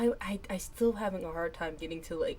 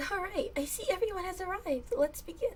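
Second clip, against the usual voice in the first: teacher character voice; 13 semitones higher, 4 dB louder than usual. Acted. Teacher character addresses class.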